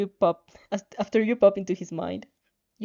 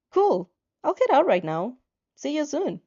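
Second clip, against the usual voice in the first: higher, louder quick cheer; cheerful reply; 5 semitones higher, 4 dB louder than usual. Acted. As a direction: bounce with excitement. Excited reply to Izuku.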